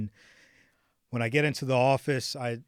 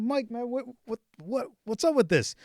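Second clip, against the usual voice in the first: emotional plea; 9 semitones higher than usual. Retold or imitated. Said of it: "but I'm almost in tears".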